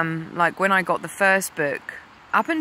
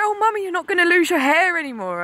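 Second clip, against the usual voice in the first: put-on high, fast, joyful child's voice; high quick childlike delight; happy daughter; 12 semitones higher, 4 dB louder, faster than usual. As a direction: gush it like a delighted girl. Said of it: "she was really happy".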